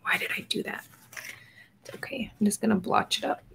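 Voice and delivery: hushed whisper fixing a mistake; whispering to herself. Real, not acted. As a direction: whisper it, fussing at your own slip. Mistake with water, talking to herself.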